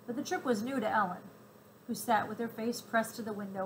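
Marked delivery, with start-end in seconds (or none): none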